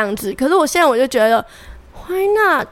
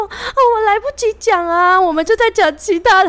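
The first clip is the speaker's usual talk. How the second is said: imitating naive girl; 9 semitones higher than usual. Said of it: "她就说".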